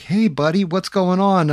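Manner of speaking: mimicking the store manager's overly friendly hello; mock friendly greeting. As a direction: play the sheepish boss greeting him sweetly. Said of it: "He's like".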